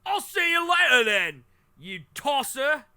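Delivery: high, loud British send-off; hammed-up British caricature; mock British accent. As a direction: squawk it in a terrible British accent. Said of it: "That's a beautiful British".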